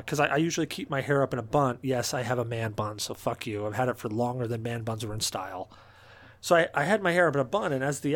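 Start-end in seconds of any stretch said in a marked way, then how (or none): none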